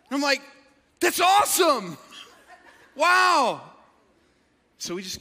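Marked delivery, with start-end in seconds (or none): 1.0-3.6 high, loud delighted exclamation; overdone enthusiasm. Retold or imitated. Pastor answering unknown churchgoer, hammed-up high enthusiasm. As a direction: gush to cover not knowing him.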